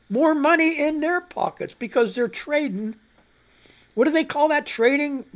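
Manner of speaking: high, loud, bitter sarcasm; angry rant. Real, not acted. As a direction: spit out the "oh yeah".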